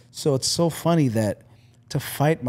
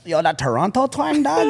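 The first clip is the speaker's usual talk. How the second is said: mock Toronto twang; 11 semitones higher, 3 dB louder than usual. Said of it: "Now people like".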